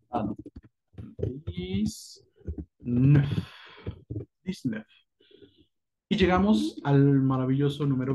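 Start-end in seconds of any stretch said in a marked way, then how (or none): 1.5-4.9 "Dix-neuf" said as dizneuf, higher at the end; French dix-neuf. Shown as a demonstration. Teacher correcting herself and modelling dix-neuf. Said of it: "la pronunciación será dix-neuf".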